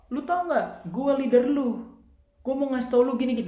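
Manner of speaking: high, pushy put-on voice of a leader talking down; bossy know-it-all leader. Leader talking down to an older member, in a high, pushy voice. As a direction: lord it over the member like his boss.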